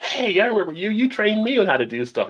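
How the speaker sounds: quoting an eager former trainee; light cheerful impression of a patron; grateful patron voice. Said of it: "people were like".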